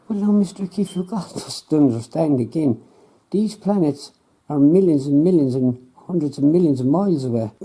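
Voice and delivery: lightly voiced know-it-all scientist; smug scientist. Scientist voice again.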